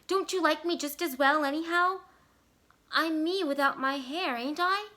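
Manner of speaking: anxious high plea. A narrator's reading. Della asking Jim if he still loves her, high and pleading. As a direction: ask for reassurance, voice lifted.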